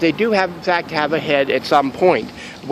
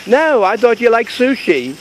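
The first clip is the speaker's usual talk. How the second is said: mock innocence; 7 semitones higher than usual. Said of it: "I'm gonna say".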